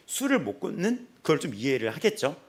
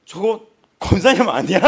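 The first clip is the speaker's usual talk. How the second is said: louder, higher surprised question; mock surprise; 5 semitones higher, 12 dB louder than usual. Onlooker spotting a deaconess in a street fight, loud surprise.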